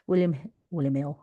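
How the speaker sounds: William Hill in Cockney accent. Real, not acted. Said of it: "you can hear my Cockney accent there".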